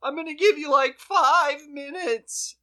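hammed-up earnest police officer; pleading cop. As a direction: beg them solemnly to be reasonable. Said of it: "he's literally like".

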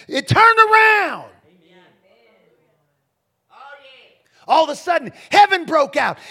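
loud, high, building sermon shout; full-force preaching. Acted.

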